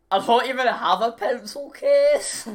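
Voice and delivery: high, loud, mock-indignant cry; indignant protest. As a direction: protest it like an outraged kid. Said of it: "idea on it was".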